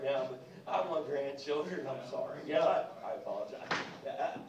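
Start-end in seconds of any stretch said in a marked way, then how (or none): none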